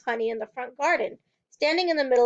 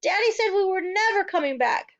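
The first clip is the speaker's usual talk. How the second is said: higher distraught telling; upset child; 8 semitones higher, 3 dB louder than usual. A narrator's reading. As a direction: near tears.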